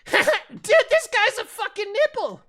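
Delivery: put-on very high, loud kid's jeer; mocking child. Little child jeering at a Costco shopper, mocking shriek. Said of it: "and then they went".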